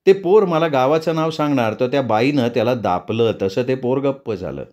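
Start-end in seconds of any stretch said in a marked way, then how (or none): none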